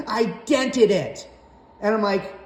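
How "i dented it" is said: high, slow sheepish mom voice; mocking mom voice. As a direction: drag it out like a guilty mother minimizing. Mom downplays the damage.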